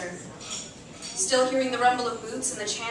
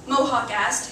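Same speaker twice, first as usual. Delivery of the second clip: ordinary delivery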